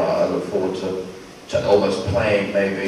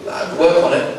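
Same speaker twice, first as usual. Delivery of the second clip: ordinary talk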